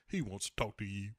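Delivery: low, soft, mildly smug aside. Retold or imitated. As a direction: say it with understated smugness. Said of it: "kinda smugly is like".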